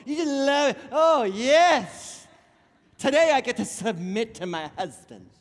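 mock-delighted, teasing the women; sarcastic. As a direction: gush over it with obvious irony. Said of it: "I'm being sarcastic".

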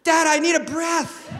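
put-on a child begging for a break, high and loud; little son pleading. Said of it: "he said".

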